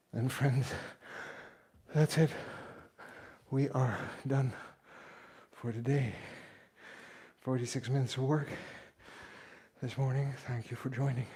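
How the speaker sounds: panting after a hard workout; breathless, gasping between phrases; out of breath. Real, not acted. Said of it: "Let me just catch my breath".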